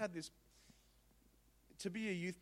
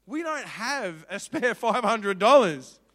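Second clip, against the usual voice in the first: slow, much louder protest; exasperated; 6 semitones higher, 17 dB louder, slower than usual. Retold or imitated. Husband telling his wife they can't afford it, slow and loud. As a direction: lay down the hard truth, loudly. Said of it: "I was like".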